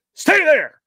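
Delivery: loud, high barked order; shouted command. Retold or imitated. Hero pointing and yelling at Willie to stay. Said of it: "and he goes".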